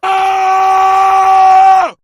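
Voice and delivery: very high, very loud comic shriek; mock scream. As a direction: let out a huge shrill shriek offstage. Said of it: "she'd go out there and she'd go".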